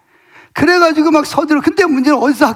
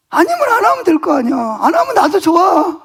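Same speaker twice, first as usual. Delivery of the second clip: annoyed retort; 6 semitones higher than usual. Retold or imitated. Pastor telling the reluctant teacher she can refuse, annoyed retort. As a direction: act out throwing it back at her in annoyance, voice up high. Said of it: "나도 막 화가 나는 거예요".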